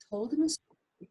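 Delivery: usual speaking voice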